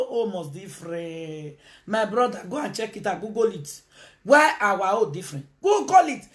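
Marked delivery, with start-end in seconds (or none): 5.6-6.2 high, loud insistent shout. Real, not acted. To viewers doubting, shouted insistent order.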